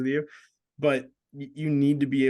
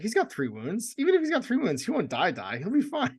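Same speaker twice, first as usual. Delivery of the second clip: much higher, breezy mock confidence; overconfident; 11 semitones higher than usual. Recalling his doomed model before the rolls, breezy and high. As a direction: brush it off like nothing can go wrong. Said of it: "I was like".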